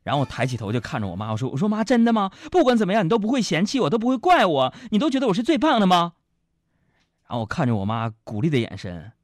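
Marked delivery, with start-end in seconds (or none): 1.7-6.1 eager son. Acted. Son eagerly seeks mother's reassurance.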